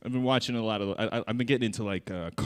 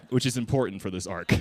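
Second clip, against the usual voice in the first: ordinary talk, nothing marked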